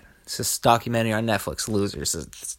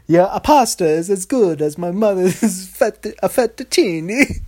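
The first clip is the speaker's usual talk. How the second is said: high, louder cartoon Italian, breaking into laughter; mock Italian accent; 7 semitones higher, 5 dB louder than usual. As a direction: ham up an Italian accent with added "a" sounds. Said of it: "every word starts with a".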